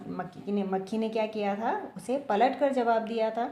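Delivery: ordinary delivery, nothing marked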